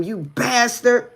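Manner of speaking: high, loud outraged outburst; retold scream. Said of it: "I screamed at him".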